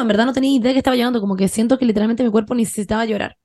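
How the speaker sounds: ordinary delivery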